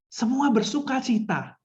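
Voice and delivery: high, drawn-out proclamation of joy; bersuka cita. Shown as a demonstration. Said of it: "mimik, misalnya loso-loso mau bilang". (seruan sukacita, nada tinggi dan lambat)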